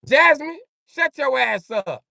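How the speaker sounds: high, loud put-on voice, slow on the last words; hammed-up telling Jasmine to hush; mock scolding.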